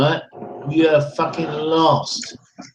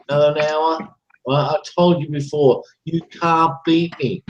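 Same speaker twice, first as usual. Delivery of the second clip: ordinary delivery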